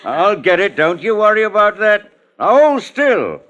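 menacing. Acted. Gardener closing in to strangle.

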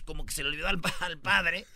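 ordinary talk

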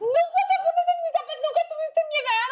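high, half-laughing fake sob; a teen complaining to her mother, fake crying; melodramatic teen. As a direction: overdo the wronged teenager.

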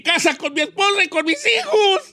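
high, loud, choking mock sob; hammed-up crying husband asking to go home; tearful wish. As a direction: beg the genie through comic tears. Married man wishing to go home.

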